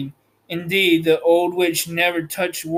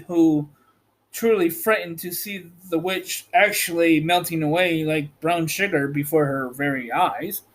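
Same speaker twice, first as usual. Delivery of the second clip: usual speaking voice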